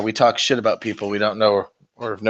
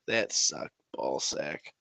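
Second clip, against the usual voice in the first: low, much softer, slow growl; deep grumble; 8 semitones lower, 13 dB quieter, slower than usual. Real, not acted. Groaning about dropped models, low and soft.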